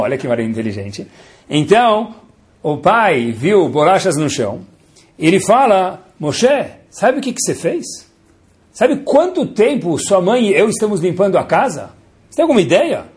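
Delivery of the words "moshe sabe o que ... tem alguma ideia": scolding father. Acted. Father scolding four-year-old son.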